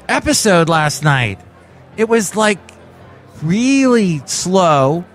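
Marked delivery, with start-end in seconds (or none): 3.4-5.1 "really slow" said very slowly to match the meaning; drawn out. Real, not acted. Mimics slowness of the episode.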